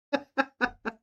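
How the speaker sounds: high-pitched chuckling laugh; amused laugh. Real, not acted. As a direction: laugh at your own joke, high. Laughing at her own joke.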